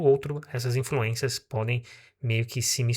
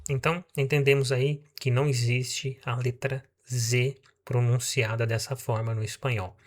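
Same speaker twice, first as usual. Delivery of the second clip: normal talking voice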